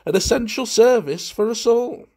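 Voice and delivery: high, loud, relishing delivery; gleeful guest. A narrator's reading. Valentine enjoying his own joke enormously, gleeful. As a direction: proclaim it with showy enjoyment. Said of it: "enjoying himself enormously".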